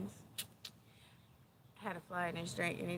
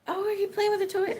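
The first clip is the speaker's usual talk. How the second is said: high, loud doggy voice; high-pitched delighted question to a pet; pet talk; 14 semitones higher, 13 dB louder, faster than usual. Real, not acted. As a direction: coo excitedly at your dog. Talking to her dog.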